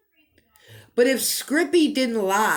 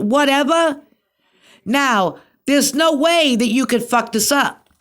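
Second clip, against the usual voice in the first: louder, higher, pressing the accusation hard; heated accusation; 5 semitones higher, 5 dB louder than usual. Real, not acted. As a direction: hammer him with it, voice raised and sharp. Accusing Scrippy in a rant.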